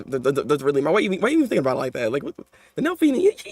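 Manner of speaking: ordinary delivery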